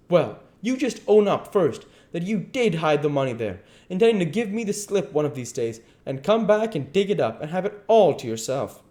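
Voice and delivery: high, loud, insistent demand; accusing duke. Acted. Duke demanding the king own up, angry insistence. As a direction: press hard for a confession.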